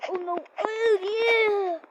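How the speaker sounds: high, loud shriek of relief; excited shriek. Real, not acted. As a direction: squeal with excitement. Near fall, then relief.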